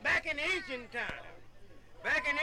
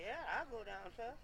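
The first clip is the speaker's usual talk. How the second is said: meek soft reply; 4 semitones lower, 10 dB quieter than usual. Retold or imitated. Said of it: "I said".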